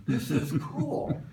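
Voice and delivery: light eager dog voice, slowing and laughing on the last words; a lightly voiced excited dog; dog's thoughts. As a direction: voice the pup's happy realisation. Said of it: "They're thinking".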